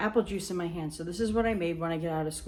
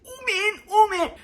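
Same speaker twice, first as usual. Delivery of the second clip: high, rising "oh, man" getting louder; high distressed whine; 14 semitones higher, 7 dB louder than usual. Retold or imitated. Sister on the swings needing to pee, high and distressed. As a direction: squeal it as you're about to lose it. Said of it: "So she's like".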